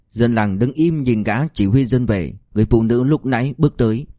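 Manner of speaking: plain reading voice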